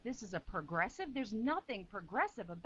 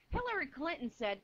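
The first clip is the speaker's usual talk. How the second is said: ordinary delivery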